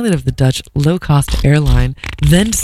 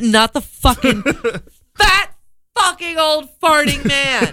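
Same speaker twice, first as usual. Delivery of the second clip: laughing outrage; 9 semitones higher than usual. Real, not acted.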